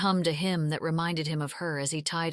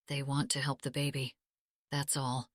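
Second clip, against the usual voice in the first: softer and lower, calming; reassuring; 4 semitones lower, 5 dB quieter than usual. Acted. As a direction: gently reassure her. Husband calming his scared wife.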